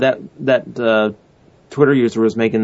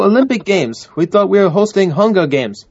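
clumsy mock Russian accent, pitched higher; bad Russian accent; 6 semitones higher than usual. Cohost mocking Sochi in a bad Russian accent. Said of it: "the accent sucked ass".

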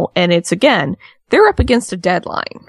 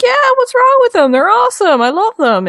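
much higher, louder girly mock voice; mock wife voice; 4 dB louder than usual. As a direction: squeak it out like a defensive wife. Imitating wife defending cowboy boots.

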